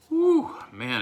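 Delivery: high, loud burning exhale; pained whew. Real, not acted. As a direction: blow out the heat sharply. Mouth burning from hot sauce.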